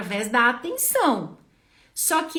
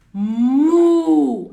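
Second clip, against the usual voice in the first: big, loud drawn-out "mu"; loud cow moo; 4 semitones higher, 12 dB louder than usual. Shown as a demonstration. Therapist showing a child the cow sound. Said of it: "eu fui mostrar pra ele, ó".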